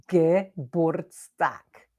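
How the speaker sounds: "Geburtstag" drawn out very slowly, each sound clear; slow pronunciation demo. Shown as a demonstration. Teacher slowly models Geburtstag for the viewers. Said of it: "Go on, say it".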